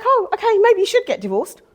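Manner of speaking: a hint of parents suddenly agreeing; mock parents. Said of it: "they were like".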